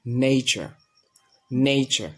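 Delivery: নেচার as "NEI-cha", long gliding first vowel; correct pronunciation. Shown as a demonstration. Teacher modelling the correct form, নেচার as "nei-cha". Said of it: "আমরা এটা উচ্চারণ করবো".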